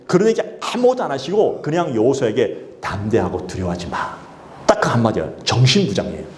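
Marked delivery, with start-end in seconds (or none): 2.8-4.2 hushed reassuring command; quiet fatherly assurance. Acted. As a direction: lower and softer, gentle. God's one word to Joshua.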